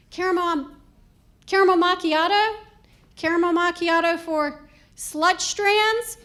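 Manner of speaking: loud barista order call. Acted. Barista calling out a coffee order, loud announcement. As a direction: project it across the coffee shop.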